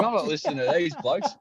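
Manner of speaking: put-on high, grumbling old bloke; an old spectator grumbling about the noisy commentators, in a high mock voice; older gentleman. Older gentleman refuses to listen.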